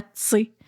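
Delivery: "tu sais" squeezed into one syllable, "tsé"; contracted tu sais. Learners ask teacher about it, "tu sais" contracted to "tsé". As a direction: drop the vowel of "tu sais", giving "tsé". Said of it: "des contractions, euh, qu'on va utiliser".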